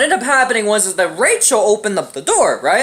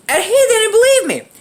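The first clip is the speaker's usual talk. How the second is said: ordinary talk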